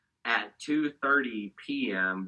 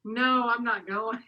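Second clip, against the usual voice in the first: slow, higher mock-stubborn no; flat refusal; 13 semitones higher, 4 dB louder, slower than usual. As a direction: dig in your heels, slowly. Refusing friend's retreat invite.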